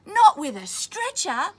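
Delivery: loud, high, slow exclaiming; amazed soldiers. Acted. Soldiers marvel at Simpson's donkey, loud and high. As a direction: proclaim the wonder of it. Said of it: "they said".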